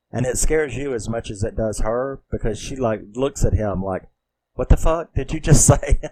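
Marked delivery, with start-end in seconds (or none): 4.5-6.0 loud, higher mock disbelief breaking into a laugh; retold stunned outburst. Woman confronts the voice she suddenly hears, loud mock shock. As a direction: act out her shock, loudly, with a laugh. Retells her stunned look at him.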